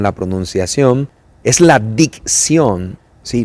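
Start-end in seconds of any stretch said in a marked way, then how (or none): 1.6-3.0 "la dicción" stretched slowly, each syllable of "dicción" stressed and clear; slow emphatic word. Shown as a demonstration. Diction teacher stressing the key term to students, slow and deliberate. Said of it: "Dicción es pronunciar bien las palabras".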